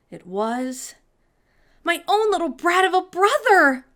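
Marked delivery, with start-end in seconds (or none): 1.8-3.9 high, loud shocked outburst; crying out. A narrator's reading. Sophie finding her brother on the list, loud outburst. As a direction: blurt it out in outrage. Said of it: "she cried".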